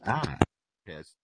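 usual speaking voice

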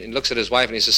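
ordinary delivery

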